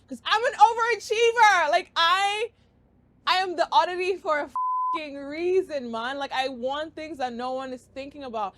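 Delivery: loud, high, rapid-fire hype; excited. Real, not acted. Hyping up her balcony podcast dream to viewers, loud and high. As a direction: pitch the dream at full volume like an overachiever.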